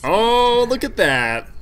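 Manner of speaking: sudden loud, high burst of surprise; surprised exclamation. Real, not acted. Caster surprised by the last pick, loud high exclamation. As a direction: caught off guard by the pick, exclaim it.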